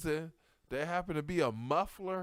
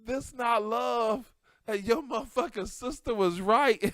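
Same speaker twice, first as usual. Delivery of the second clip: laughing realization; 6 semitones higher, 7 dB louder than usual. Retold or imitated. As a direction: admit it, cracking up. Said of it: "It's like".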